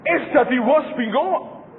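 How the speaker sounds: loud, slow, drawn-out complaint; loud slow mock confusion; comic outburst. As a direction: wail it slowly for a laugh. Joking about English grades.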